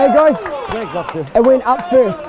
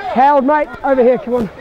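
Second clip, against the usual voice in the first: ordinary talk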